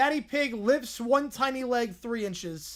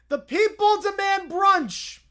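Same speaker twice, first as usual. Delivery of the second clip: louder, higher protest call; rallying demand; 8 semitones higher, 6 dB louder than usual. Acted. Bear rallying for brunch. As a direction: demand it like a crowd.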